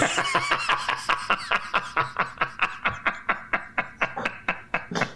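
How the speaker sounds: high, breathy burst of laughter; cracking up at the punchline; big laugh. Real, not acted. Laughs at own joke.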